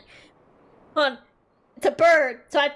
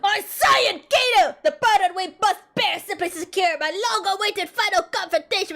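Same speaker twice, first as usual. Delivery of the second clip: high, hammy declaimer; theatrical warrior character; 8 semitones higher than usual. Acted. Game warrior addressing his companion, grandly theatrical. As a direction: declaim like a pompous stage hero. Acting theatrical villain lines.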